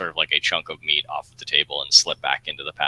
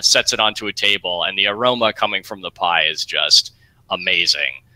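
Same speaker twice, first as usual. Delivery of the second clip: ordinary talk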